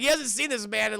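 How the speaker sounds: normal talking voice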